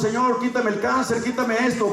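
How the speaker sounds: a lightly voiced desperate prayer; mock pleading prayer. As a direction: play the impatient believer begging God. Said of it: "y entonces nomás oramos".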